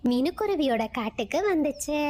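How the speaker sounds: ordinary delivery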